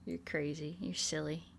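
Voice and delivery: usual speaking voice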